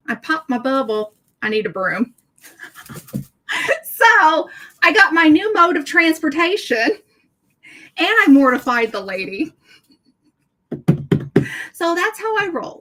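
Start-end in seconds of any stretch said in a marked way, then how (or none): 2.6-3.2 quiet breathy laugh. Real, not acted. Laughs at her own joke. 3.8-12.8 much higher and louder, slowing down; gleeful punchline. Real, not acted. Punchline of her broom story.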